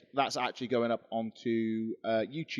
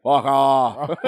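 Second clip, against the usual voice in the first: Mick Foley; 9 dB louder than usual. Pretend. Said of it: "What's the worst you're gonna say?".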